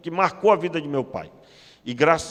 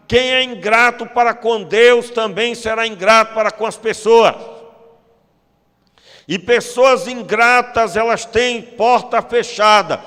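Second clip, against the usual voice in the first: loud, slow, emphatic sermon; preacher driving home gratitude, slow and loud; full-force preaching; 6 semitones higher, 8 dB louder than usual. Acted. Pastor to the congregation, preaching loud and slow.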